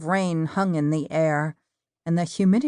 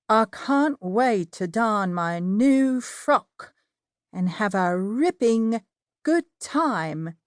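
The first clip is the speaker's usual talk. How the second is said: a hammed-up, bad British accent, higher and slow; terrible British accent; 5 semitones higher, slower than usual. Narrator joking with Elaine about the party, bad British accent. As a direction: put on a deliberately awful British accent. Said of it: "in my best British accent, which was terrible".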